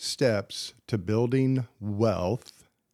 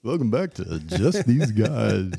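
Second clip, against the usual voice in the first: slow crooning host intro; smooth it out like a lounge singer; lounge lizard voice; 4 dB louder, slower than usual. Shown as a demonstration. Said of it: "why do I adopt a lounge suit lizard voice".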